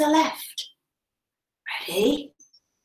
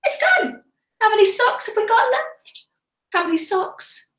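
much higher, thrilled; kids show host; 7 semitones higher than usual. Acted. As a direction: marvel for the children. Sock blown off.

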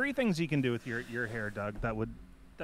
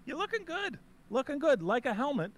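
high mock voice; 11 semitones higher than usual. Playing along, high voice.

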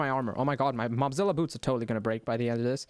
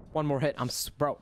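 ordinary delivery

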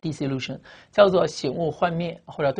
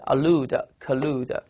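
"allude、collude", each stressed on its second syllable; much slower than usual. Shown as a demonstration. Teacher shows students where "allude" and "collude" take stress, second-syllable stress. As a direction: lean on the LUDE of "allude" and "collude". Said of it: "这边注音都在第二音节".

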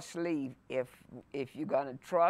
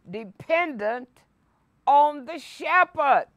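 higher, louder preacher's voice, building fervor; fervent preaching; 7 semitones higher, 7 dB louder than usual. Acted. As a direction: preach it out to the congregation. Preaches the psalm at full force.

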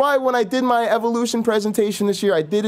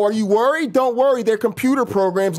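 ordinary talk

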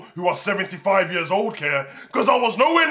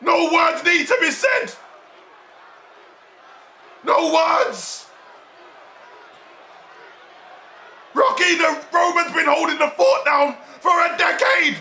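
emotional hype; 5 semitones higher than usual. Real, not acted. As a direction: announce it like a moved ringside fan. Hyping the big face-off.

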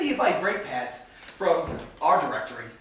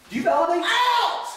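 shout; 11 semitones higher, 6 dB louder than usual. Acted. Shoved out of office.

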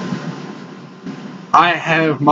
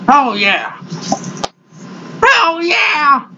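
higher, shouted oh yeah; excited shout; 8 semitones higher, much slower than usual. Real, not acted. To viewers, the dip kicking in, an excited shout. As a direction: belt it out in delight at the dip.

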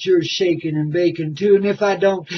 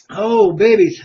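ordinary talk

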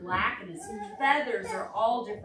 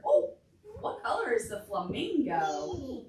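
normal talking voice

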